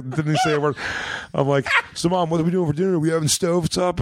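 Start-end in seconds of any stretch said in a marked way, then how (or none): 1.9-4.0 high, fast chatter to mom; hammed-up innocent question, pitched up; oblivious teen self. Son chatting to mom about dinner, oblivious.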